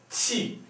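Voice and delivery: qi hoch ansetzen, scharf fallend; qi kurz abfallen lassen; fourth tone. Shown as a demonstration. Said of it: "Fourth tone".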